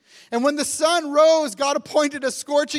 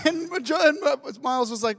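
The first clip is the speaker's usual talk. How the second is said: normal talking voice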